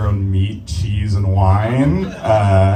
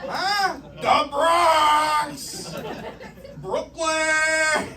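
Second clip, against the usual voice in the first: high, drawn-out comic hollering; mock New Yorker; much slower than usual. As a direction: call out like a hammed-up local, pitched way up. Mock New Yorker calling out boroughs.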